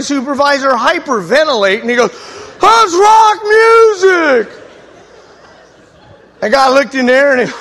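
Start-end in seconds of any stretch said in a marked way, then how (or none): 2.6-4.5 high, loud, drawn-out shocked cry; scandalized yelp; shocked gasp. Retold or imitated. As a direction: recoil in horror at the records. Upperclassman alarmed, hyperventilating.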